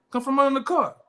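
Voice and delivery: high, urgent call to a buddy under a car; a friend yelling that the cops are here; urgent warning call. Retold or imitated.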